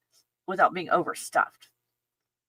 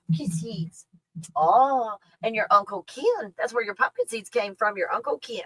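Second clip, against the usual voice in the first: higher voice cooing to a dog; cooing pet talk; 4 semitones higher than usual. Real, not acted. Owner telling puppy about Uncle Ken's gift, high pet talk.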